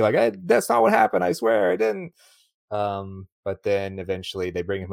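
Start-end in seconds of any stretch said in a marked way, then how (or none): 0.1-2.1 high, louder mock pleading; protesting his innocence. Host mimics accused Steve-O in the film, high protesting. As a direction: plead like a cornered man, pitched way up. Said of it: "he's trying to say".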